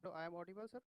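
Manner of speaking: faint, distant mic voice; speaking too softly into the mic; barely audible. Real, not acted. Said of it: "very low voice".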